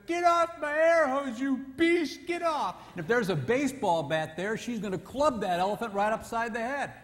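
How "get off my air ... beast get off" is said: high, loud, slow angry shriek; mock furious wife. Wife yelling at elephant husband on her hose. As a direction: shriek at the beast to get off.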